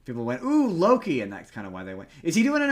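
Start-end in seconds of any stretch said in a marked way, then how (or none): 0.4-1.2 high, louder fan-style "ooh"; hammed-up delighted recognition; mock excitement. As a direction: imitate an excited moviegoer, pitched way up. Acting out excited moviegoers' reaction.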